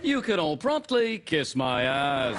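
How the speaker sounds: slow, sliding mock-presenter cadence; British TV presenter. Sidekick insults the audience, slow British presenter parody. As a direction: draw the insult out in presenter sing-song. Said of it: "talk like someone on television in Britain".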